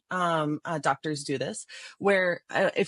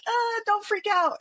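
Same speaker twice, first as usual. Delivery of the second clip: mock-nervous squeak, much higher and slow; 12 semitones higher, slower than usual. Doctor nervously calming a patient, in a mock-nervous squeak. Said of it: "we're like".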